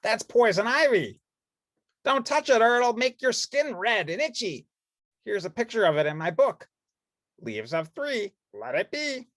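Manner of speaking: high, urgent explaining voice; Bert warning. Acted. Bert warning Ernie about poison ivy, urgent. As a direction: warn firmly but kindly.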